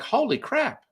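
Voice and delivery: very high astonished exclaim; stunned. Retold or imitated. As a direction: blurt it out in disbelief. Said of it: "and you're like".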